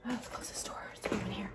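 winded whisper after the uphill run; out of breath. Real, not acted. After running up the cold hill, breathless whisper. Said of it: "I'm out of breath".

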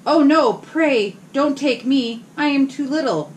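high, slow pleading squeak; frightened little goat. Acted. Little goat begging the troll to spare him, high and slow. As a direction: beg the troll, tiny and scared. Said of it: "said the billy goat".

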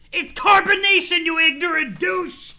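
high, very loud troll outburst; exasperated shout. Acted. Irritable troll corrects his clueless friend, exasperated shout. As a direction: explode at him, dragging out the insult.

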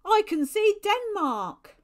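high, very loud, drawn-out call; slow, ringing lookout's cry; excited shout. A narrator's reading. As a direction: shout the sighting across the water. Lookout pointing, calling out land sighted.

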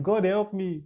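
high, pleading plea to God; a hint of desperate begging; mock pleading. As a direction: beg God for help, half acted. Voicing a believer begging God.

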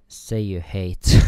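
drawn-out playful yell; slow shout. Real, not acted. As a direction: bellow the choice for a laugh. Streamer shouts out the dialogue option.

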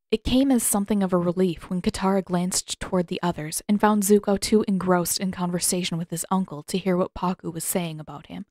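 usual speaking voice

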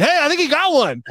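high, eager fan voice on Twitter. Retold or imitated. Fan on Twitter tells him about a loose ball, high and eager. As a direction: play the hopeful fan tweeting at him. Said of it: "be like".

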